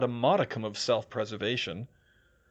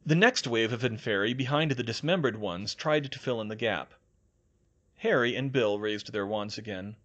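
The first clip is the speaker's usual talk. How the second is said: ordinary delivery, nothing marked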